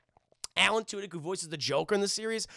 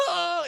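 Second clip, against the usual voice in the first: mock scream; 12 semitones higher, 4 dB louder than usual. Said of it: "It's like".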